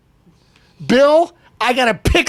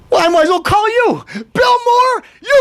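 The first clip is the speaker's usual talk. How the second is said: calling out; 13 semitones higher than usual. Real, not acted. Calling out Bill Maher.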